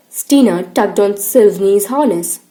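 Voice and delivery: ordinary delivery, nothing marked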